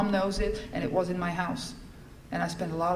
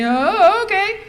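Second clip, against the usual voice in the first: surprised okay; 12 semitones higher, 10 dB louder, much slower than usual. Retold or imitated. Dan surprised at being left.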